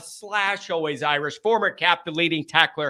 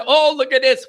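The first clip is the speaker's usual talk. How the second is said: ordinary talk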